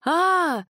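short high yell. A narrator's reading. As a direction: cry out in alarm. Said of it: "заорал Павел".

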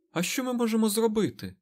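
high-pitched, impatient protest; exasperated outburst. A narrator's reading. Mat can't stand it any longer, exasperated. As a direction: burst out, unable to hold it in. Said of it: "не витримав Мед".

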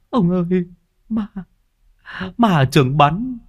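put-on high, whimpering child's voice; a scared little boy crying to his neighbour; terrified crying boy. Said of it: "vừa khóc vừa nói".

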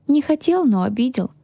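sighing. A narrator's reading. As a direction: sigh it out, hurt. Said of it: "вздохнула я". (обиженно, со вздохом)

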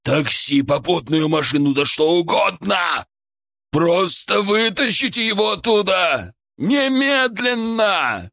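desperate rising shout; shouting into phone. A narrator's reading. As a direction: yell it, pleading and frantic. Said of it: "орал он в трубку".

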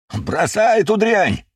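высокий протяжный крик; крикни издалека, протяжно и высоко; окрик. A narrator's reading. Окрик бегущему к нему соратнику. (кричит протяжно)

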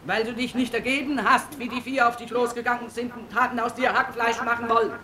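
mother berating her son; angry scolding. Acted. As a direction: scold him for risking his life. Mother scolds son after slapping.

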